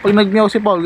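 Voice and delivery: usual speaking voice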